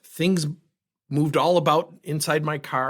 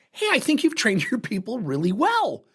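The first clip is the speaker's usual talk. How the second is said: higher, quick, sarcastic compliment; sarcastic praise; 7 semitones higher than usual. Retold or imitated. Sarcastically praising the owner about his staff, high and quick. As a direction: praise him with a bite, voice up.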